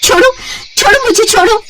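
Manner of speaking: very high let-me-go cry; frightened protest. Acted. Simran pleads with the milkman to let go, very high. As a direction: push him off in panic.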